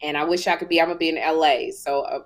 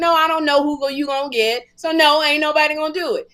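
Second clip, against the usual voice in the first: retold refusal; 7 semitones higher, 4 dB louder, faster than usual. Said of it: "So he was like".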